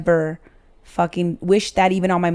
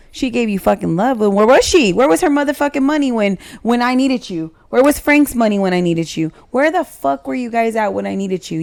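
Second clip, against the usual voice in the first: heated, higher-pitched rhetorical questions; indignant rebuttal; 5 semitones higher than usual. Retold or imitated. Mother rehearses answering her son's praise of stepmom, indignant and heated. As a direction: fire off outraged questions, pitch jumping up.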